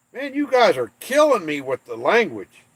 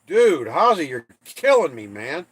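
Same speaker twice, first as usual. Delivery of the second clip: normal talking voice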